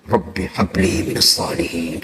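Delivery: soft, slow, hushed prayer into the ear; retold whisper. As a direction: murmur it softly and slowly, as if whispering into a child's ear. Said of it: "Saya bisik telinganya".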